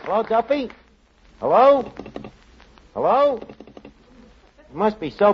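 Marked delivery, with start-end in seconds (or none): 0.0-3.4 calling into phone. Acted. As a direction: shout hello into the receiver, no answer. Shouting into a dead phone.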